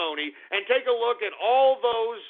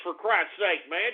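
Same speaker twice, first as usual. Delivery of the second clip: ordinary talk, nothing marked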